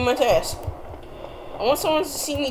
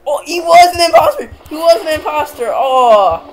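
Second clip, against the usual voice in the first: surprised; 6 semitones higher, 8 dB louder than usual. Real, not acted. Reveal of imposter.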